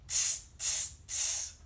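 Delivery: three sharp "tss" hisses; unvoiced hissing monkey chatter. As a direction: mimic cheeky monkeys going tss. Imitates the monkeys' noise.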